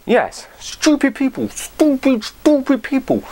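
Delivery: mocking. Mocking gym staff who ban dropping weights, sing-song jeer. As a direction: taunt them in a mocking, lifted voice.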